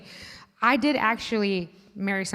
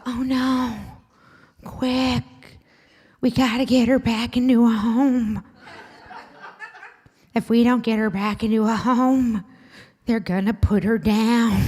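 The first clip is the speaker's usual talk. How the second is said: mom voice; 3 semitones higher, slower than usual. Retold or imitated. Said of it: "She's like".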